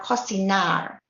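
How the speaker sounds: "cocinar" with a stressed, long NAR; stress on final syllable. Shown as a demonstration. Teacher showing an infinitive verb, "cocinar" stressed on NAR. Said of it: "on the final syllable".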